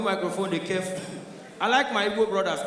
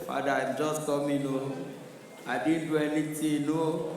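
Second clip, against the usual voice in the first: lightly mock pleading, softer; a hint of a scared man pleading; begging; 6 semitones lower, 5 dB quieter than usual. Yoruba man answering God on the phone, meekly begging. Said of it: "he go start to beg".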